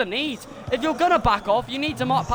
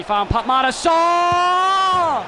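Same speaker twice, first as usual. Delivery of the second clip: slow, drawn-out yell, higher and louder; frustrated shout; 7 semitones higher, 6 dB louder, much slower than usual. Real, not acted. Fan yelling at the telly as the opponent scores, shouting. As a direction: bellow it at the screen in disbelief.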